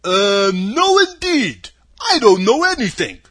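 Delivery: loud, slow, high-swooping scarecrow; sad scarecrow. A narrator's reading. Scarecrow confessing to Dorothy he has no brains, sad. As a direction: lament in a big husky voice, drawn out. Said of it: "he answered sadly".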